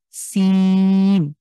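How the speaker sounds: very slow সিন with a long hissed স; drawn-out seen. Shown as a demonstration. Teacher drags out সিন to show its hiss. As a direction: stretch সিন out slowly. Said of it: "স একটা আওয়াজ আসছে".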